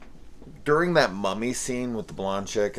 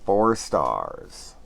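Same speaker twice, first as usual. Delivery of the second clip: ordinary talk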